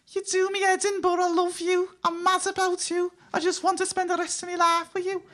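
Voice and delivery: very high Scouse lover; Scouse accent. Retold or imitated. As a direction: declare love like a Liverpool romantic lead. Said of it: "there will be a Scouser who'll say".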